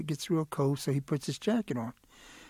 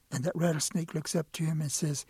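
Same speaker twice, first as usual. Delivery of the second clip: plain reading voice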